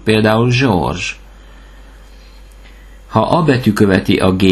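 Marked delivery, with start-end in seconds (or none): 0.5-1.0 George with both Gs as "zh"; soft French G. Shown as a demonstration. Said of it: "G E betűcsoportot Z-nek ejtjük".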